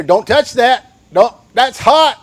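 warning parent. Retold or imitated. Parent warning a small child about the hot stove, sharp and loud. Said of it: "you'd hear".

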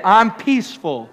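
loud emphatic declarations for the crowd to repeat; ringing call for the congregation to repeat; called-out affirmation. Shown as a demonstration. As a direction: proclaim each line so they echo it back. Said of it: "Say it".

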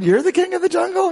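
very high, quick squeaky monkey voice; a cheeky little monkey, disbelieving; incredulous monkey. Acted. Preacher voicing the doubting monkey to the lion, high and squeaky.

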